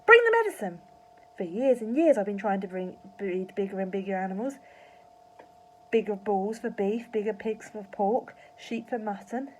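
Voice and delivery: loud excited farmer yelling. A narrator's reading. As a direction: yell it, then rattle on eagerly. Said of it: "Mister Cranky yelled".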